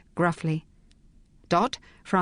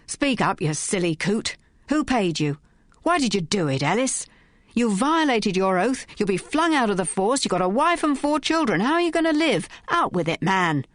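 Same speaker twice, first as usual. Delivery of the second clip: angry bellow; 4 semitones higher than usual. Acted. Inspector berating the bribed constable, angry shouting. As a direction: tear into him, climbing in pitch.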